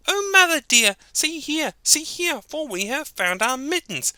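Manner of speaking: very high eager kitten voice; excited high kittens. Acted. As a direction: squeak with joy to mother. Kittens call happily.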